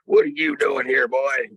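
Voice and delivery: a big man shouting from the doorway; retold yell. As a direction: bark it at the kid like a threat. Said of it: "he yelled at me".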